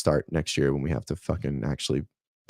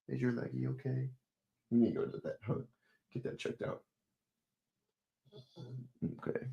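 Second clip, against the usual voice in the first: tender pet talk; 5 semitones higher, 14 dB quieter than usual. Real, not acted. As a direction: fuss gently and quietly over the dog. Comforting limping pet dog.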